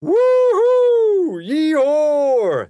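very high, very loud yee-haw whoops; distant crowd whooping woo-hoo and yee-haw; wild whooping cries. A narrator's reading. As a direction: holler it across the plain like a rowdy crowd. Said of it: "peculiar cries such as".

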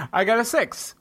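normal talking voice

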